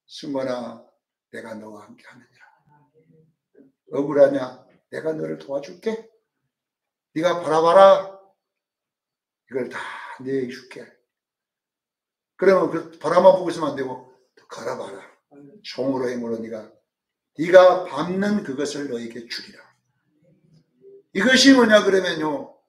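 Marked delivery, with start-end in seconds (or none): 0.1-10.9 lower, lightly voiced God, reassuring; a hint of God comforting His servant. God speaks to the believer. 14.5-19.6 lower, lightly voiced God, reassuring; a hint of God comforting His servant. God commands the believer.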